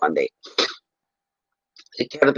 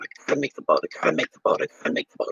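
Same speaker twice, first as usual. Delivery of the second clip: anxious muttering to self; 6 dB quieter, faster than usual. Retold or imitated. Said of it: "I'm going".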